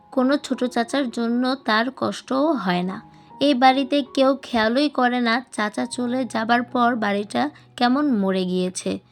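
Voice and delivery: ordinary delivery, nothing marked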